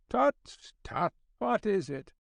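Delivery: put-on old Scottish editor tutting; kindly Scotch accent. A narrator's reading. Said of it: "in his kindly Scotch accent".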